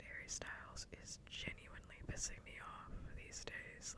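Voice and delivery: hushed, whispered rant. Real, not acted. Streamer fed up with seeing [name], whisper. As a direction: whisper your complaint into the mic.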